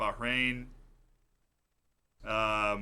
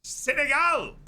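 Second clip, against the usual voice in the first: loud, high-pitched announcement; big answer reveal; 11 semitones higher, 5 dB louder, slower than usual. Real, not acted. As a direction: call out the winning answer. Big reveal after suspenseful pause.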